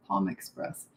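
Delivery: a low, soft second try at Palm Express; quiet low repeat. Shown as a demonstration. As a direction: repeat it under your breath, puzzling over it.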